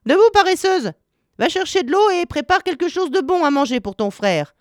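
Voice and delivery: high-pitched scolding cry; shouted order. A narrator's reading. Witch shaking Gretel awake to fetch water, scolding cry. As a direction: rouse her with a sharp, high command. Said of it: "la secoua pour la réveiller et lui cria".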